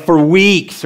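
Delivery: usual speaking voice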